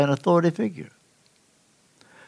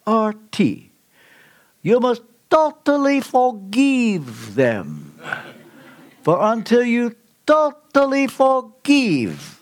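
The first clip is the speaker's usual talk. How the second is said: put-on high, drawn-out Romanian accent, solemn command; 4 semitones higher, slower than usual. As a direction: imitate a Romanian friend, slowly and emphatically. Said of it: "hear him now in his Romanian accent".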